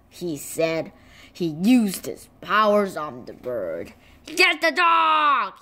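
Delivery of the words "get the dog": calling command. Acted. Genie ordering the bird to attack, calling out. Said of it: "He called".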